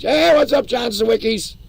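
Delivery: high, loud cheerful hello; excited greeting. Real, not acted. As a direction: call out a happy hello. Greeting a viewer.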